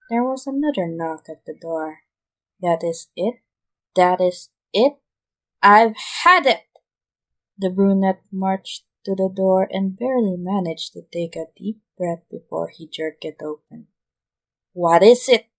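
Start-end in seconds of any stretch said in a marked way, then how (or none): 2.6-6.7 fed-up outburst getting louder; snapping. A narrator's reading. Overworked boss snaps at yet another knock, building anger. 14.7-15.5 loud annoyed demand; irritated bark. A narrator's reading. Fed-up Chuna snaps at whoever knocked, loud. Jerking door open, irritated.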